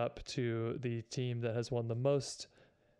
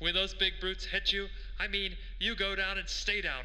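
put-on higher, old-time radio announcer read; 1930s radio broadcaster; 6 semitones higher than usual. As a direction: keep the vintage sportscaster voice going. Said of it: "nineteen thirties radio broadcaster voice".

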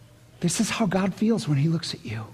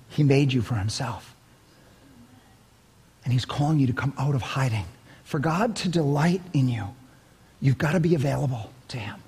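normal talking voice

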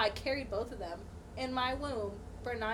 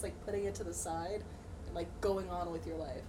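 usual speaking voice

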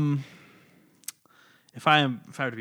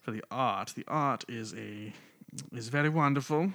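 put-on British accent. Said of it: "should I do a British accent?".

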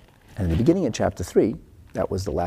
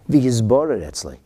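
ordinary delivery

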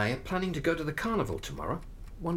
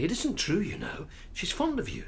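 ordinary talk, nothing marked

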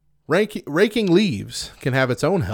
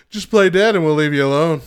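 bear voice; 4 semitones higher, 9 dB louder than usual. Pretend. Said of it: "what if that was just something the bears spread around".

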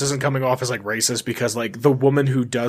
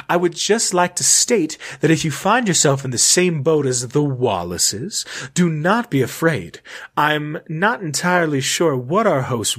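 highfalutin fancy lady. Acted. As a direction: address posterity as if full of yourself. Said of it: "very highfalutin, like, just full of themselves".

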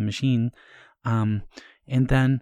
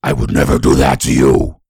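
deep, loud demonic voice; 11 semitones lower, 10 dB louder than usual. Acted. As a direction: sound like something has taken over. Possessed demon voice bit.

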